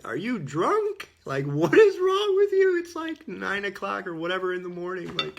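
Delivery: hammed-up outraged crowd voice; mocking bewildered crowd. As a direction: shriek it like a scandalized bystander. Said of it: "And everybody's like".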